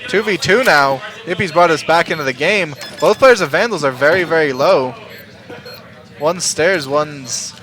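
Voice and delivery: caster rising from calm to hype; steady call swelling into excitement; play-by-play commentary building up. Acted. Casting the match, building hype.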